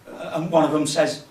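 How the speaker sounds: normal talking voice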